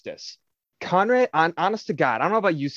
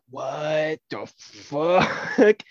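long drawn-out disbelieving what the fuck; stunned what the fuck; much slower than usual. Retold or imitated. Walker finding Conrad dead, drawn-out stunned disbelief. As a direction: let it hang in slow shock.

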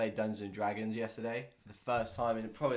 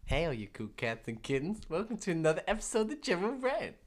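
sing-song, higher-pitched impression of a show host; Carol Baskin; 7 semitones higher than usual. Host opens the podcast as a big-cat woman, lilting impression. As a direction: greet the listeners in a put-on, lilting voice. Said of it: "Was that my good Carol Baskin voice?".

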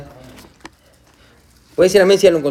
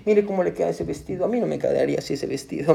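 envious aside; 4 semitones lower, 8 dB quieter than usual. Acted. Envious person eyeing another's dress.

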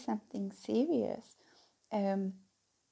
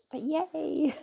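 higher, louder little "yay"; playful cheer; 6 semitones higher, 5 dB louder than usual. Real, not acted. Host welcoming anyone who wants to listen, playful cheer. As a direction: give a light, upbeat cheer.

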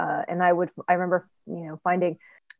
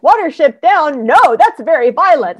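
a hint of a shocked father, higher and louder; mock-appalled dad refusing a violent cartoon; stern dad; 7 semitones higher, 8 dB louder than usual.

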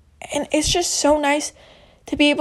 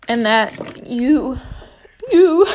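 voice cracking; 3 dB louder, much slower than usual. Real, not acted. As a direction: voice cracks mid-word. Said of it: "my voice just cracked".

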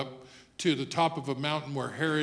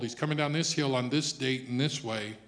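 normal talking voice

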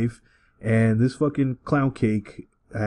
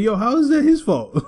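incredulous; 11 semitones higher than usual. Retold or imitated. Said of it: "And I'm like".

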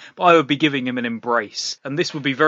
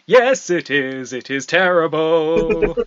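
slow, louder, beaming agreement; overly cheerful; 4 dB louder, slower than usual. Acted. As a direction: agree with forced delight. Relentlessly happy reply about rain.

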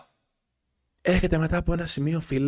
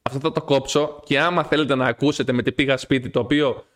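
ordinary talk

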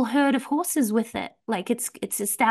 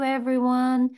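usual speaking voice

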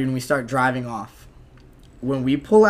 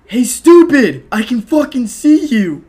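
much higher, louder, slow taunting yell; mocking cop yell; 9 semitones higher, 9 dB louder than usual. Cop yelling at the fallen runaway, louder and higher. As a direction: call him out like a smug cop.

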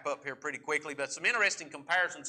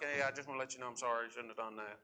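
quick, soft throwaway sorry; half-hearted apology; 6 semitones lower, 10 dB quieter, much faster than usual. Retold or imitated. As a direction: mumble it fast to get it over with. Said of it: "as small as possible".

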